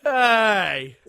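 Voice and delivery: high, very loud holler; mock shouted hey. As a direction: belt out a drawn-out call. Loud mock call answering the story.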